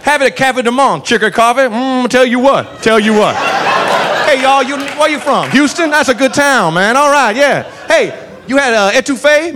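friendly tour-guide cop. Retold or imitated. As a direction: rave about it like a folksy local guide. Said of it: "proceeds to give us tourist tips".

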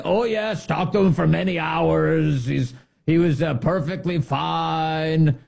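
high-pitched, drawn-out parody of an official; sing-song mock assurance; mock reassurance. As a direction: imitate an official's too-eager assurance, stretching the ending. Mocks officials' assurances of health.

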